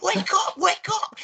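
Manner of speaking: high-pitched panicked mock scream; imitated screaming. Imitating the singer waking the sleeping band, high mock screaming. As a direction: yell the alarm way up high, imitating him. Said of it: "he starts screaming".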